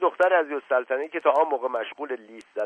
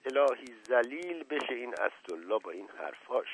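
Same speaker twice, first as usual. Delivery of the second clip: soft grumble under the breath; muttering; 9 dB quieter than usual. Acted. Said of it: "زیر لب گفت". (غرغر زیر لب)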